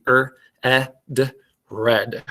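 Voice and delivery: "er, eh, d" as separate sounds, then "red" blended; sounding out. Shown as a demonstration. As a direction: phonics teacher segmenting, then blending "red". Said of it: "I'm gonna give you the sounds for each word".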